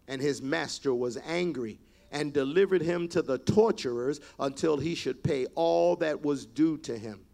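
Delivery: normal talking voice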